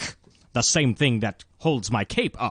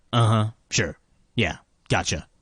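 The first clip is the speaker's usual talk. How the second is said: normal talking voice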